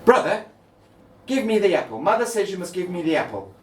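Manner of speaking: high, loud little sister demand; little sister ordering her brother; demanding child. Acted. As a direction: insist like a bossy little girl.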